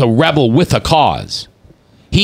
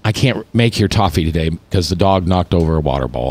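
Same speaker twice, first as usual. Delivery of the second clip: defeated; 7 semitones lower than usual. Shown as a demonstration. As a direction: read it like you have given up. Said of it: "I feel defeated".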